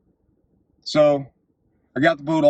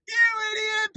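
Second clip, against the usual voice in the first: mocking jeer; 4 dB quieter than usual. Passengers dismiss him from deck.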